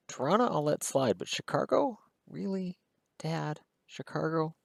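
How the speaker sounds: mock disbelief. Teenage son mocking his dad's "Chicargo", in disbelief. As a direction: act baffled at your dad's word. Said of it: "I'm like".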